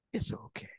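soft whispered comfort; soothing whisper. Acted. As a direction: hush them like a baby. God soothing like parent.

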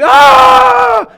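sudden high shrieking cry of panic; panicked cry. Acted. Preacher acting out a panicked believer, frantic yelp.

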